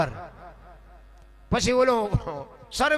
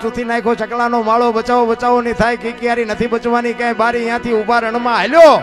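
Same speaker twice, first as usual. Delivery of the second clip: loud, driving folk chant building from steady to full force; rhythmic poem recital; 3 semitones higher, 8 dB louder than usual. Acted. Folk performer chants Bhikhabhai's earthquake poem to the crowd, loud rhythmic chant. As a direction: pound out the verses like a bard over music. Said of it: "એ બાપુ એ અમારા ભીખાભાઈ રબારીએ લખ્યું છે".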